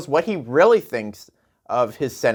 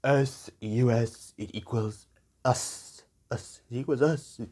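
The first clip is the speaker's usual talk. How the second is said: slow, lower mock Trump voice, softer and drawn out; a lightly voiced Trump mocking his spelling; 3 semitones lower, 5 dB quieter than usual. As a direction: parrot Trump's point back slowly, half-hearted. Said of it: "Yes, Donnie".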